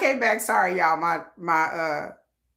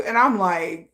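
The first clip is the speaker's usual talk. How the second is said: ordinary talk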